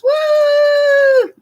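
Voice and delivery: high, loud whoop of joy; excited cheer. Real, not acted. Cheering a viewer's membership.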